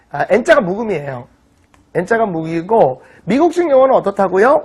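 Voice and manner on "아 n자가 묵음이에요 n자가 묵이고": ordinary talk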